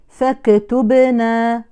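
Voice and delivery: "فاكتبنا" with the kaf bounced like a qalqala letter, slowly; wrong echoed kaf. Shown as a demonstration. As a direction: demo the mistake: a jolted kaf in "فاكتبنا". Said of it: "وهذا خطأ".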